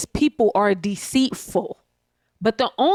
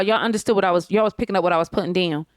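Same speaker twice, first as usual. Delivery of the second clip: ordinary delivery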